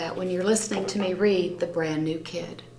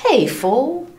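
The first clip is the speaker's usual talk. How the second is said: shouted taunt; 6 semitones higher, 8 dB louder than usual. Acted. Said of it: "he shouted".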